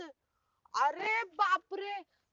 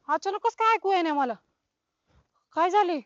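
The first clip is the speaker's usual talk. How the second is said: alarmed loud cry; 7 dB louder than usual. Acted. Panicking about her hearing.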